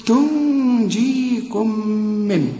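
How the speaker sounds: "تنجيكم" with a long nasal hum on the ن; held ghunna. Shown as a demonstration. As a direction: linger on the nasal sounds in "تنجيكم من". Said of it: "النون بعدها الجيم هنا عايزة غنة برضه".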